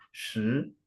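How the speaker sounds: ordinary talk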